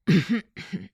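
throat-clearing cough; short stalling cough. Acted. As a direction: clear the throat before making excuses. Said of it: "кашлянул".

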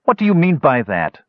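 high-pitched, ill-humored challenge; growled question. Acted. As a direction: growl it without real harshness, refusing to be teased. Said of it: "Basalt growled, unwilling to be teased out".

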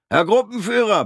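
high, loud yelled call; bellowing. Acted. Corporal bellows loudly to the sergeant. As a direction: bellow across the square. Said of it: "brüllte er".

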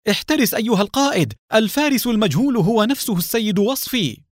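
fast, high urgent shout. A narrator's reading. Ramzi warning the leader, shouting fast and high. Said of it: "وصاح رمزي".

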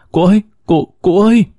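high, rising cry of grief, half sobbing; sobbing wail. Acted. Dreamer wails after her departing ancestor, sobbing cry. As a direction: call after him through tears, voice climbing. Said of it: "gào nức nở".

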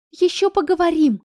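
выше и громче, с угрозой; пригрози однокласснице; угрожающе. A narrator's reading. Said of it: "пригрозила". (Семакина грозит Ивановой)

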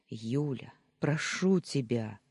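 ordinary delivery

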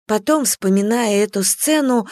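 usual speaking voice

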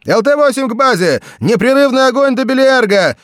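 very high, loud pilot reply; over a pilot headset. A narrator's reading. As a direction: snap the order back over radio. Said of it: "ответил немедленно пилот".

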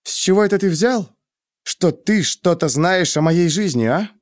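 high-pitched indignant questioning; furious challenge. A narrator's reading. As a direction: demand an answer, outraged. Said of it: "Глаза у неё сделались бешеные". (возмущённо, с вызовом)

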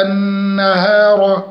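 النهار with a full heavy r at the end. Shown as a demonstration. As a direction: thicken the ra of النهار. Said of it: "الراء نفخم الراء".

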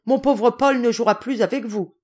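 high, loud, emphatic scolding; indignant exclamation. Acted. Mother forbidding the children to play with Paul, indignant. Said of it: "s'écria-t-elle".

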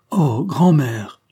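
slow, loud, high wail breaking into sobs; a child's anguished sobbing cry; crying out in tears. A narrator's reading. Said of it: "s'écria Angèle, fondant en larmes".

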